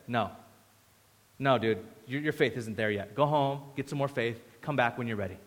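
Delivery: low, soft, offhand refusal; casual brush-off. Acted. Preacher imagines Jesus sending Jairus home, casual brush-off. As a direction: turn them away quietly, like a laid-back dude.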